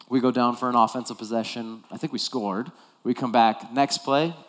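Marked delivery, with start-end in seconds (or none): none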